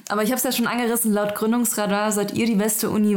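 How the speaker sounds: normal talking voice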